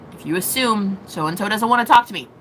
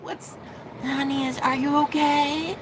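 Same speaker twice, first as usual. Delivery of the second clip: soft, higher, half-asleep mother; half-tired mom; 4 semitones higher, 6 dB quieter than usual. Retold or imitated. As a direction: croak it like a mom woken early. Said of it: "how a mom does, like, when she's half tired".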